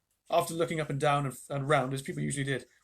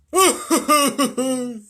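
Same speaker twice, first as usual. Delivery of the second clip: loud, high, dopey guffaw; dim giant's laugh; 10 semitones higher, 12 dB louder than usual. A narrator's reading. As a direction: burst out in a witless hooting laugh. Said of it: "not very intelligent laughs".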